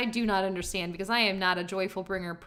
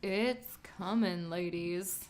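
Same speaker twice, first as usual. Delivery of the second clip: sultry announcer voice; 4 semitones lower, 7 dB quieter than usual. Acted. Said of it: "She said that in a sultry voice".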